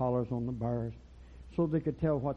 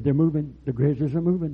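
tag; 8 dB louder than usual. Retold or imitated. Rangers report the bears moving.

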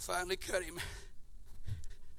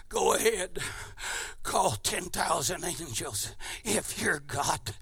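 whispering devil; 6 semitones lower, 12 dB quieter, slower than usual. Acted. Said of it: "a devil whispering in his ear".

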